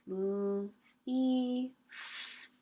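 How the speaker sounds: "leaf" in three short separated sounds; separate sounds. Shown as a demonstration. Teacher counting three sounds for children, sounded out separately. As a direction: say L, ee and F apart, each lightly held. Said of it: "I heard three sounds".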